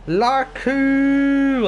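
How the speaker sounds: high, drawn-out shout; loud name call. Real, not acted. Streamer reading out a strange chat name, drawn-out call.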